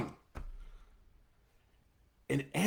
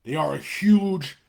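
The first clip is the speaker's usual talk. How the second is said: slow, louder, hammering each word; heated, emphatic plea; passionate rant; 3 semitones higher, 6 dB louder, slower than usual. Real, not acted. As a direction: drive the warning home hard. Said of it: "one thing I'm passionate about".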